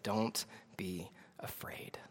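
very soft, low and slow; hushed, spaced-out comfort; tender reassurance. Acted. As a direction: soothe a frightened man quietly. Reads Jesus reassuring fallen John.

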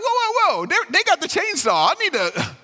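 high, alarmed whoa-whoa backing away; mock panic. Listener imagining the angry person backing off in comic panic. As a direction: play it as comic alarm at a chainsaw.

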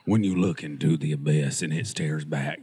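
very low, put-on voice; mock philosophical voice. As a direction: intone it deep like a mock sage. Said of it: "You've got the tone down".

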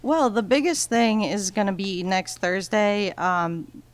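ordinary delivery

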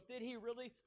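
high, taunting little question; a light taunt from the tempter; mocking enemy voice. Preacher voicing the enemy at Starbucks, high taunting question. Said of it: "the enemy goes".

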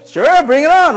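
loud, high, gung-ho acceptance; eager diner. Acted.

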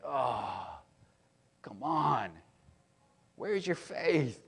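mock exasperated scolding, pitch climbing; chiding. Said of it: "like, chiding his followers".

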